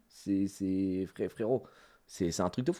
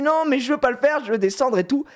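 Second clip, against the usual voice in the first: put-on protesting kid; 9 semitones higher than usual. Acts out the boy protesting.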